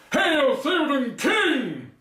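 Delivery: slow, high, grand hail; quoting a movie line. Retold or imitated. Aragorn hailing the king, slow grand greeting.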